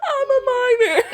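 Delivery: high, loud laughing squeal; real high cackle. Real, not acted. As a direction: shriek it through laughter. Laughing joke.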